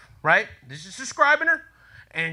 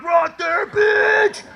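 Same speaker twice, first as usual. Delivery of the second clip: cocky shout; 13 semitones higher, 3 dB louder, much slower than usual. Retold or imitated. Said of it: "And I was like".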